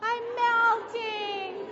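high, drawn-out witch wail on melting; slow witch wail. Retold or imitated. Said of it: "what the witch said".

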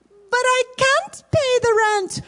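high pleading heroine wail; damsel in distress. Acted. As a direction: beg helplessly, hammed up. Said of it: "So here we go".

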